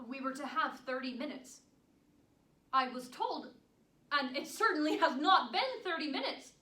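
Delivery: loud, indignant telling-off; indignant protest. Acted. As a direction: scold the guard, hitting key words hard. Visitor indignantly argues with prison guard.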